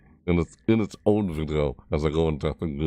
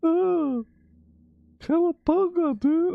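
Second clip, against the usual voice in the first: very high surfer squeal; Ninja Turtle voice; slower than usual. Acted. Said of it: "that's the Ninja Turtles".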